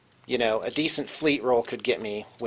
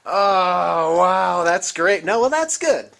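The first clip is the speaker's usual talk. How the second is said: excited; 5 semitones higher, 6 dB louder, much slower than usual. Real, not acted. Excited at Machine Spirit rule.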